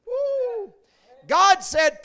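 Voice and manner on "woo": high, soft preacher's woo; a high falsetto woo of delight; excited whoop. Real, not acted. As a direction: let out a quick, high woo. Preacher's exclamation mid-sermon.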